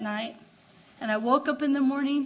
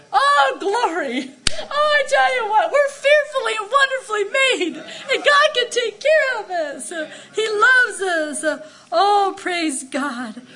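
higher and louder, laughing and crying; tearful ecstatic preaching; 6 semitones higher, 6 dB louder than usual. Real, not acted. Preacher cries and laughs telling the church God loves them. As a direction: preach through tears of joy.

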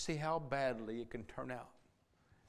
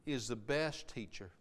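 loud, high preacher's cry; impassioned sermon delivery; fiery preaching; 7 semitones higher, 14 dB louder than usual. Acted. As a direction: preach it out full force to the church. Preaching at full force.

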